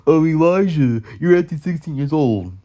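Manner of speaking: loud mocking whine of nagging adults. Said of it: "wanna cap and talk shit about me".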